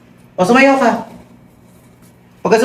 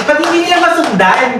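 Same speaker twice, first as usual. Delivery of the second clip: high exasperated complaint; frustrated coach; 9 semitones higher than usual. Real, not acted. Coach frustrated that dancers miss her beat, high exasperated. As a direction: demand why they can't follow.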